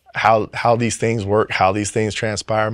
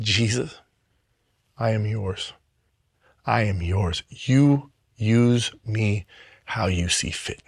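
tearful prayer; 3 semitones lower, 6 dB quieter, slower than usual. Retold or imitated.

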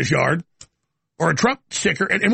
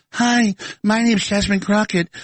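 Jasmine Crockett; 2 semitones higher, slower than usual. Retold or imitated. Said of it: "Jasmine Crockett in her old voice".

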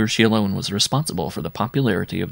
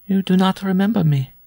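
wounded woman visitor; 11 semitones higher than usual. A narrator's reading. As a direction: say it high, as a hurt reproach.

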